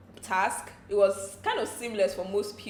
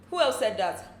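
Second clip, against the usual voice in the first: ordinary delivery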